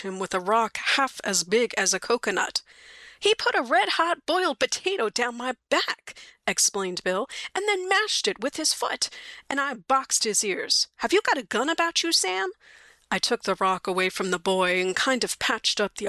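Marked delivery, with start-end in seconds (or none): 3.2-6.1 high, indignant complaint; high-pitched outrage at what was done; aggrieved Bill. Acted. Bill complains after boy's attack. 7.5-12.5 high-pitched grievance, building to a plea; indignant victim, pitched well above normal; aggrieved Bill. Acted. Bill complains after boy's attack.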